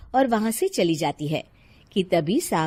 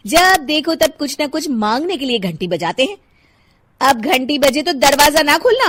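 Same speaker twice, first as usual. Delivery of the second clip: lightly voiced annoyed mother; irritated Shilpa; 6 semitones higher, 9 dB louder than usual. Mother complaining to her child about neighbours, mildly irritated. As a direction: grumble about the neighbours, a touch louder.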